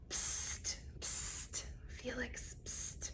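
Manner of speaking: hushed secret whisper. A narrator's reading. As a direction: whisper across the room as if hiding it.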